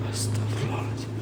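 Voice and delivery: frightened whisper; whispered prayer. Real, not acted. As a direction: breathe it out under your breath. Scared in a dark room. (bisikan istighfar ketakutan)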